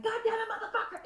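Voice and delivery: high-pitched mock curse at other drivers; exasperated outburst. At drivers running stop signs, exasperated high curse. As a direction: blurt it out, fed up with red-light runners. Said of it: "I'm like".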